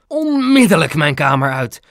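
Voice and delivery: loud order to get out; head nurse yelling at an intruder; angry shout. A narrator's reading. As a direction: throw them out with a forceful shout. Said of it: "schreeuwde ze tegen meneer Moejal".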